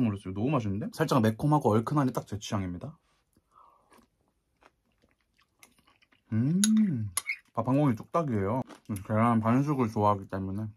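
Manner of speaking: talking between bites; chewing while talking. Real, not acted. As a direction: mouth partly full of food. Seen 4: Takes forkfuls of food into mouth, chews between phrases.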